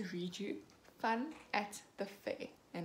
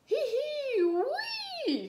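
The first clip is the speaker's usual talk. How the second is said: high loud piglet squeal; high giggling wee; gleeful squeal; 7 dB louder, much slower than usual. A narrator's reading. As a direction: squeal down the slide. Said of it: "cries George".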